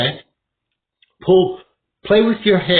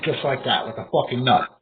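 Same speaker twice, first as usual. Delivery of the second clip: normal talking voice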